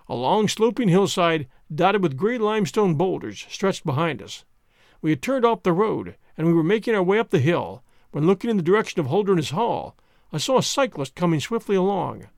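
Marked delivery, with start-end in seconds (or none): none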